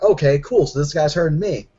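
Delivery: ordinary talk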